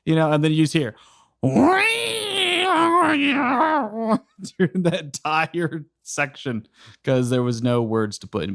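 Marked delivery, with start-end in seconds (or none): 1.4-4.2 long, high animal squeal; drawn-out mock creature cry; imitated animal noise. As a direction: wail out a silly animal sound. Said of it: "doing all the random animal sounds".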